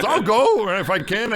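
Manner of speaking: retold eager yes; eager volunteering. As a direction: imitate another keen volunteer, drawn out. Retold second friend volunteering.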